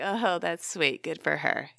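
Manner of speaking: slow, soft sarcastic politeness; mock polite reply. Listeners without parents, slow sarcastic polite reply. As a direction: drag out the fake politeness.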